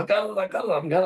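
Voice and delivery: high, frantic imitation of his mate; mate's voice. Mate insisting he has the croc, high frantic voice. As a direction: squeak it up like a guy frozen in panic. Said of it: "And he's like".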